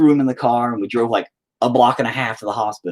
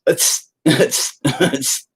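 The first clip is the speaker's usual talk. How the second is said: quick hissed squirts; imitated blood spurts; 5 semitones higher, much slower than usual. Showing what a hit artery does, hissed spurts. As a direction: mimic pulsing squirts with short hisses. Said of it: "your blood goes".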